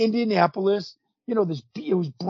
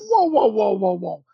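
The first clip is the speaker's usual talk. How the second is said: retold alarmed objection. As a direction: cut in to halt the plan. Retold protest to the producer.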